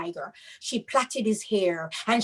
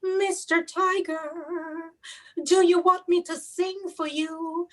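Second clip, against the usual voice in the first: slow, higher, coaxing sweetness; sweet voice; 7 semitones higher, slower than usual. Acted. Mother sweetly coaxing the sleepy tiger, slow and high. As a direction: lure him with a honeyed voice. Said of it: "she used her beautiful voice".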